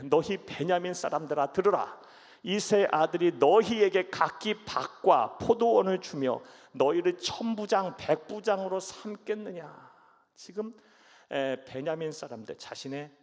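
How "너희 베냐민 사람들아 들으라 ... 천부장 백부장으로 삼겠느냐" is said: loud, slow commanding rebuke; Saul's rebuke. Acted. King Saul scolding his Benjamite servants, loud and slow. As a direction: thunder at the servants like an angry king.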